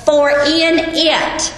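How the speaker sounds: "for in it" drawn out slowly, word by word; slow emphasis. Shown as a demonstration. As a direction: spell out the phrase slowly for the class. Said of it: "look at the setup of the verb".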